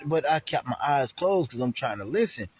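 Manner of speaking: ordinary delivery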